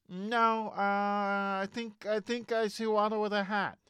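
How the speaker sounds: high, nasal-free, light George Lucas take; George Lucas impression. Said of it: "that's my George Lucas".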